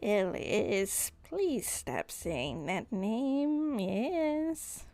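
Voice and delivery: slow, drawn-out creature plea; Nanoxus pleading. Acted. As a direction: beg slowly, stretching and bending the words. Game master plays Nanoxus.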